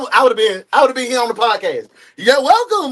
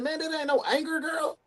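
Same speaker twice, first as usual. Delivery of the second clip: usual speaking voice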